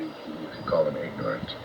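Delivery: ordinary talk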